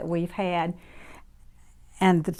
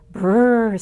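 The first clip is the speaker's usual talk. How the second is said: shivering brrr; 2 semitones higher, 9 dB louder than usual. Acted. Mouse feels winter cold.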